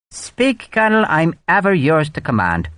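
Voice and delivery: high, sugary Irish brogue, mock servile; bow and scrape with a smirk. A narrator's reading. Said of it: "He knew he was being mocked".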